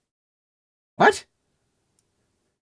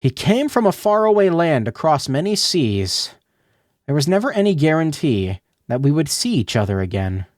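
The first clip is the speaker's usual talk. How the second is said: put-on young girl character. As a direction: speak as the longing girl remembering him.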